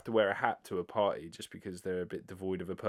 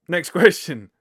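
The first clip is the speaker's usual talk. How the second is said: laughing; 8 semitones higher, 14 dB louder, slower than usual. Real, not acted. Laughs reading next question.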